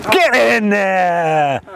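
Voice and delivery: long drawn-out celebratory cry; triumphant cheer. Real, not acted. Coach celebrates his own punchline, drawn-out triumphant cheer. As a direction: stretch it out in mock triumph.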